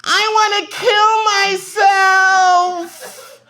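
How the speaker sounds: high, slow drawn-out yell. Retold or imitated. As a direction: wail it out across the office. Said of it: "one of my coworkers yelled out".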